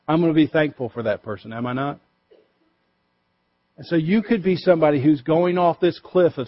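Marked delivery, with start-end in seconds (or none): none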